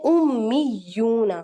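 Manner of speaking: उम्मीयूना with the doubled m held long through the nose; slow, linger on the mm in उम्मीयूना; held nasal doubled m. Shown as a demonstration. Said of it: "दो मीम निकाले मैंने".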